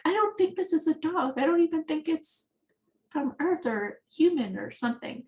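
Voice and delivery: mock skeptical sister. Retold sister doubting the creature.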